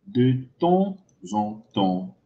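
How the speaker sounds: "De temps en temps" slow and soft, word by word; pronunciation model. Shown as a demonstration. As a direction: model "De temps en temps" low and slowly, spacing out the words. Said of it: "Vous prononcez comme ça". (« De temps en temps » lent et doux, mot par mot)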